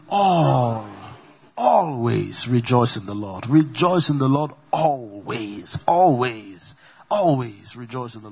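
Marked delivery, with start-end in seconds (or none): 0.1-2.5 first part of "Always" stretched long and loud; stress on ALWAYS. Shown as a demonstration. Preacher showing the congregation it is every way, stress on "Always". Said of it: "Is it just one way?". 4.7-6.7 "Always" drawn out, with a long first syllable; stress on ALWAYS. Shown as a demonstration. Preacher driving home rejoicing in every way, stress on "Always". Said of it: "Is it just one way?".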